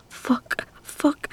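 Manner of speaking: ordinary talk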